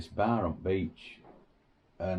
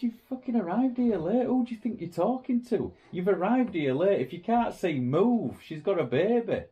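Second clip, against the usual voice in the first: angry retort; 10 semitones higher than usual. Retold or imitated. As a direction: tear into the rude tourist. Confronting Brits at the beach.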